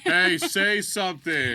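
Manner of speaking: loud, slow Say something; demanding shout. Retold or imitated. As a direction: bark the order slowly. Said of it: "Shinso voice".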